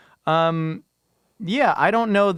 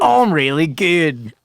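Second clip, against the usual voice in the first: put-on Australian accent; 6 dB louder, slower than usual. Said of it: "Australian accents".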